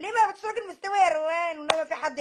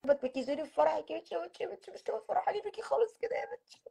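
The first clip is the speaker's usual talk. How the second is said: soft, choked weepy mother; mother tearing up as she does her makeup; tearful mother; 2 semitones higher, 8 dB quieter than usual. Acted. Mother sobbing softly to her daughter before the wedding.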